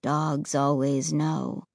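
deep, slow and cold; low, flat tone. Acted. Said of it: "in a low, flat tone".

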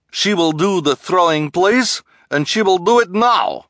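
high, loud theatrical declaration; grand disguised voice. A narrator's reading. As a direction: announce grandly in an assumed voice. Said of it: "grandly in her disguised voice".

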